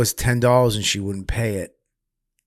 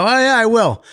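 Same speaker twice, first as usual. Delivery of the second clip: higher, louder eager name-dropping; eager retold chatter; 11 semitones higher, 5 dB louder than usual. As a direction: sell the connection eagerly. Retold eager reply to attacker.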